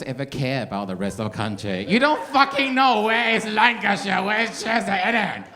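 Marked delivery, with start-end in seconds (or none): 1.9-5.4 high, loud mock outrage; mock rant. Comic ranting at Londoners about geography, mock furious. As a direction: rant at them like you're fed up.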